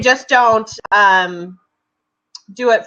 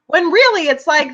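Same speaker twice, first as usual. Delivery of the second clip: ordinary delivery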